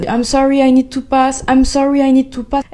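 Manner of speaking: put-on hurried polite French accent; French-accented English. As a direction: apologise your way through the crowd in French-accented English. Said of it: "en anglais en plus".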